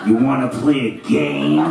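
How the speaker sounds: the masked killer calling his victim; Scream killer voice. Retold or imitated. Comic making a prank call to a girl, in a low creepy Scream voice. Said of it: "we'd do the Scream voice".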